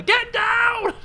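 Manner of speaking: big loud high yell, imagined; screamed. Retold or imitated. Son imagines yelling at his mother on the chair. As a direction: scream it from the doorway. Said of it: "I'd have screamed at her".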